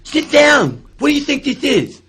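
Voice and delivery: hammed-up outraged owner stopping the show; angry club owner. Owner jumps on stage to stop the dancing, furious shout. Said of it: "he talked like this".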